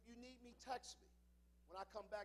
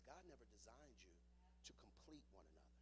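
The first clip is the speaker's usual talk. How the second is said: hushed whisper; 6 semitones lower, 11 dB quieter than usual. Real, not acted. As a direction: drop to a whisper for the point. Pastor drops to a hush.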